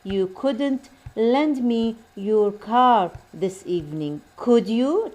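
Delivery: slow, spaced-out request rising on "could you"; teacher modelling a rise on "could you"; rising intonation. Shown as a demonstration. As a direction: drop on "evening", then lift "could you" upward. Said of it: "تلاحظون هاي rising intonation، هاي نغمة مرتفعة".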